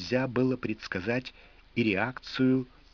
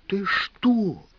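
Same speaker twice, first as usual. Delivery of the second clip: urgent whisper; 8 semitones higher, 4 dB louder than usual. A narrator's reading. Man shocked, tries to calm her.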